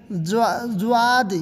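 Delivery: جواد with a j-w glide at the start; wrong jw reading. Shown as a demonstration. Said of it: "اس طرح نہیں".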